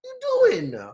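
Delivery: very high, drawn-out squeal of disbelief; comically high-pitched objection; mock protest. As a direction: plead in an exaggerated falsetto. Acted protest to the commentator.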